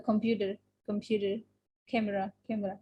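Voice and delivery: computer and camera said softly with even syllables; level, unstressed computer and camera; flat, without stress. Shown as a demonstration. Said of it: "don't say the word in flat way".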